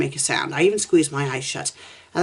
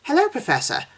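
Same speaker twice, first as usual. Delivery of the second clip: put-on high, sweet girl's hello; sweet cheerful girl; 11 semitones higher than usual. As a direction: greet him brightly like a cheerful little girl. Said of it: "a sweet, cheerful voice".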